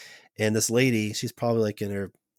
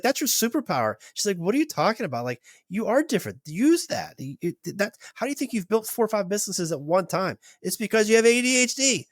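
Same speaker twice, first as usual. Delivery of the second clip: higher, insistent pep talk; emphatic encouragement; 10 semitones higher than usual. Retold or imitated. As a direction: push him to own his difference. Therapist encouraging him emphatically.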